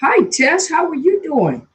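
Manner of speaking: bright greeting. Real, not acted. Greeting viewers joining live.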